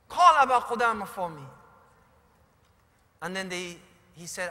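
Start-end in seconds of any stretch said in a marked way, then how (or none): 0.1-1.5 louder, pleading call for Aba Qudama; urgent call. Acted. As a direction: call out with the last of his strength, louder. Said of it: "a weak voice came from behind".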